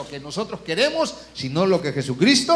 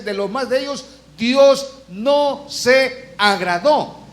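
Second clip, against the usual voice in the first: very slow and loud on "Dios no se agradó", rising on Dios; drawn-out loud quote of the verse; emphatic scripture reading; 6 semitones higher, 6 dB louder, slower than usual. Acted. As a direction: hammer the verse home slowly. Quoting the verse again.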